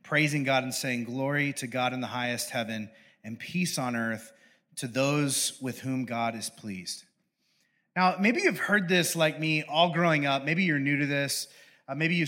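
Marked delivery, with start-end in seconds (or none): 1.0-7.0 quiet, slow reading of the praise; soft scripture reading. Acted. Pastor reading the angels' song, soft and slow. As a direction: let the verse settle softly.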